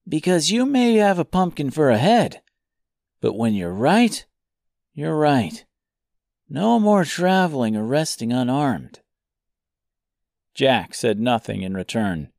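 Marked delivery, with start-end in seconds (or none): 0.1-9.0 put-on boyish voice. Tip voiced in story.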